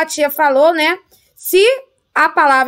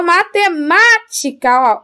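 matemática pitched up on a strong MÁ; stress on MÁ; 4 semitones higher, slower than usual. Shown as a demonstration. Teacher finding the antepenultimate syllable, matemática stressed on MÁ. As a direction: lean hard on MÁ in matemática. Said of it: "ó, última, penúltima, antepenúltima".

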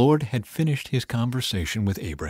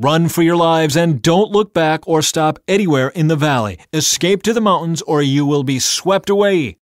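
loud, high urgent command; urgent order; 6 semitones higher, 7 dB louder than usual. A narrator's reading. An angel ordering Lot's family to flee, loud and urgent. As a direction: order them to flee at once. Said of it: "one of the angels ordered".

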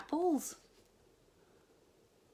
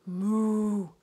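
cow moo; 10 dB louder than usual. A narrator's reading. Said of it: "said the cow".

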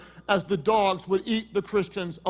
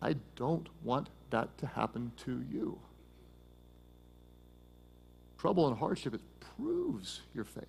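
much softer and lower, intimate; earnest hushed plea; 8 semitones lower, 12 dB quieter than usual. Real, not acted. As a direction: lean in and speak it from the heart. Pastor's earnest plea to listeners.

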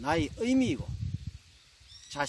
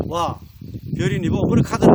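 gruff Gyeongsang dialect comeback; Gyeongsang husband; 2 semitones higher, 13 dB louder, faster than usual. Acted. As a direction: brush off your bride in blunt Gyeongsang intonation. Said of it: "경상도 신랑이". (퉁명스러운 경상도 사투리 남편)

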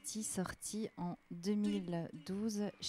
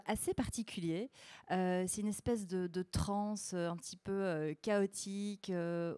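normal talking voice

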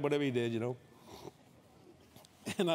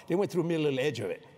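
ordinary delivery

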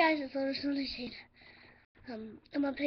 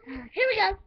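high, loud bye-bye; excited goodbye; 7 semitones higher, 6 dB louder, much slower than usual. Real, not acted. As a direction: sign off with a happy shout. Signing off excitedly.

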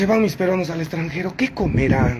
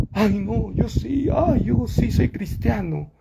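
mock pious hypocrite; 7 semitones higher than usual. Mocking hypocrites' pious claim.

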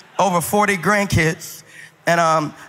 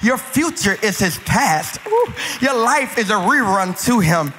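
preaching; 3 semitones higher than usual. Acted. Pastor preaching to the congregation in a rising cadence. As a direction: preach it, building each line.

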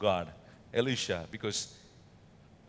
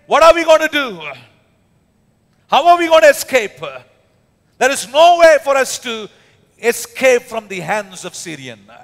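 much higher, much louder frantic cry; desperate servant shouting in fear; panicked servant; 8 semitones higher, 13 dB louder than usual. Acted. Servant's panic voiced by preacher.

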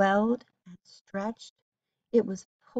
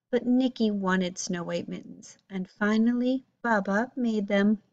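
plain reading voice

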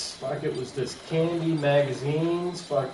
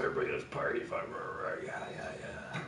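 whispered mock cheer; 11 dB quieter, faster than usual. Mocking Randy's hype.

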